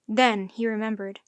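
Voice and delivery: ordinary delivery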